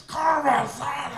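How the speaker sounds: high, slow, all-out yell of the horse's name; screaming announcer. Retold or imitated. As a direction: scream it like a race caller losing it. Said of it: "he's screaming".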